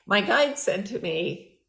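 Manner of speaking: ordinary talk, nothing marked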